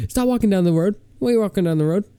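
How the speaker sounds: high, scolding mock-mother voice; drunk mom. Drunk mom calling back the fleeing kid, high mock scold. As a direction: imitate a tipsy mom calling someone back. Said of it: "she's like".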